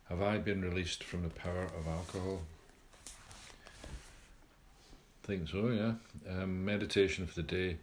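measured devotional reading; reading aloud. Acted. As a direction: read it like a daily reflection. Reads daily AA reflection aloud.

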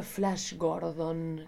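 normal talking voice

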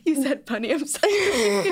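slow laughing apology; laughing tease. Real, not acted. Sister mocking sister's pun while laughing. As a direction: tease her while cracking up.

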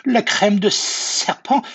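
high, loud, slow cry of alarm; alarmed snake. Acted. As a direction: echo it in horror as the snake. Snake alarmed at being food.

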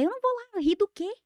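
high-pitched imitated protest; mock refusal. Wife imitating husband refusing her shows, high mock protest. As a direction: refuse in a mildly hammed-up high voice.

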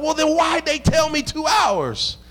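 high, slow indignant shout; retold angry outburst. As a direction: demand it like a cheated customer. Angry call to the plumber.

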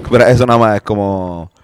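ordinary delivery

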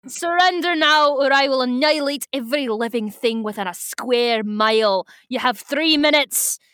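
theatrical villain's ultimatum with a put-on accent; hammed-up evil commander; Visser Three. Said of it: "since I've done my Visser Three voice".

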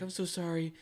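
high, fluttery apologetic voice; mock apology. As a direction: imitate a flustered girl apologizing. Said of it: "she was like".

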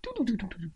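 high, soft rapid "du-du-du"; spinning sound effect. Acted. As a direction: patter out a whirling noise.

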